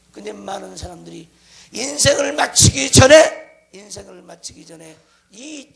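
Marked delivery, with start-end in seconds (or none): 1.7-3.7 loud, slow, stretched 인생을 마치기 전에; emphatic preaching shout. Real, not acted. Preacher warning congregation before life ends, emphatic shout. Preacher stresses phrase, then repeats it. 3.7-5.0 much softer 인생을 마치기 전에; subdued repeat. Real, not acted. Preacher repeating warning to congregation, subdued voice. Same phrase repeated softly after shout.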